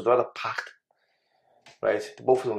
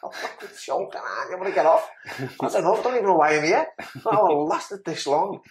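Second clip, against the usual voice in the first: higher, half-laughing moan; half-laughing protest, pitched up; retold laughing complaint; 8 semitones higher than usual. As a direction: play it as a fed-up joke at yourself. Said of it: "I was like".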